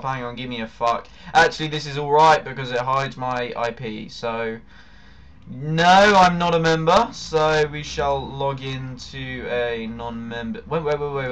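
ordinary talk, nothing marked

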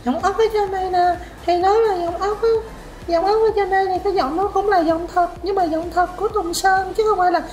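very high, thin head-voice imitation. Vocal coach mimicking a student's natural voice, high head voice. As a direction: talk way up in falsetto. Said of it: "giống như cái giọng của [name] vậy".

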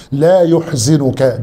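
لا يحزنك slow, ya-h-zu-nk with open first vowel and damma on the zay; slow dictation-style reading of لا يحزنك; careful spelling-out. Shown as a demonstration. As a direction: spell out يحزنك slowly, syllable by syllable. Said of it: "بفتح أوله وبالحاء والزاي المضمومة".

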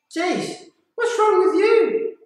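very high, drawn-out scolding question; imitated exasperation. Friends to the panicked diver back aboard, high exasperated scolding.

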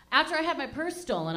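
normal talking voice

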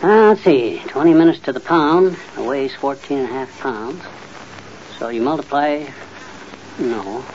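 muttered working-out, softening as it goes; muttering. Acted. Husband working out turkey cooking time, muttering to himself. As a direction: mumble sums to yourself, halting between steps. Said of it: "what are you muttering about".

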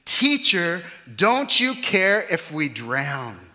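loud, slow, desperate plea; desperate cry. Acted. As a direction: cry out over the storm. Disciples crying out in storm.

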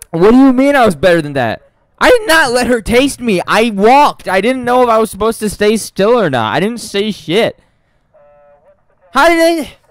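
high, loud outraged complaining; real outrage, pitched high and loud; indignant protest. Real, not acted. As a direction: protest the unfair death. Indignant protest after dying.

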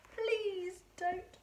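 high, pleading little appeal; praying. A narrator's reading. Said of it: "he prayed".

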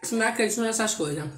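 bites vegetable between phrases; talking while chewing. Real, not acted. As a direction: mouth partly full. Seen 0: Bites green stick at lips while talking, then chews it.

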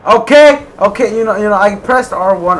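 high, loud complaint easing off; worked-up, frustrated insistence; exasperated protest. Real, not acted. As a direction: protest loudly at the game. Protests after failing a jump.

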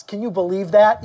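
usual speaking voice

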